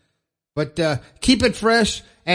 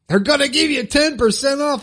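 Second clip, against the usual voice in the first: high, excited sales pitch; hyped-up promo; 9 semitones higher than usual. Pretend. Host hyping a sponsor discount to listeners, playfully excited. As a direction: pitch it up like a big announcement. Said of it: "I need some special sound effects for that".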